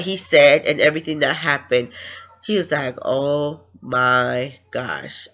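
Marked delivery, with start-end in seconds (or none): 3.0-5.3 very slow, stretched-out, lower pitch; drawn-out disbelief. Retold or imitated. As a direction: retell stunned disbelief word by word. Hearing a confession, in disbelief.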